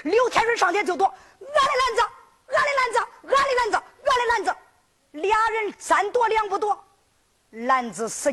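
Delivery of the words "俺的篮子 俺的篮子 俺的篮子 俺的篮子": high, insistent repeated protest; tug-of-war cries. Acted. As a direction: cry out high while grabbing it back. Two characters fight over a basket. (高声争抢叫喊)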